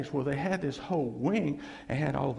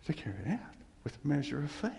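whisper; 2 semitones lower, 7 dB quieter, faster than usual. Real, not acted. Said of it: "unvoiced whisper on the line".

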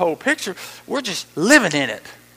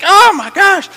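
very high, loud exclamation; mock dismay; 12 semitones higher, 13 dB louder, slower than usual. Preacher mimicking someone overwhelmed by the world, loud mock dismay. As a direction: blurt it out in exaggerated shock. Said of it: "You're just like".

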